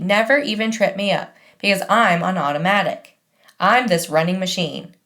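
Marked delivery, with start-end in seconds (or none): none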